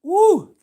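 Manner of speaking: very high, loud hype yell; excited high whoop. Real, not acted. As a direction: let out a wild whoop. Celebrating with Gatorade.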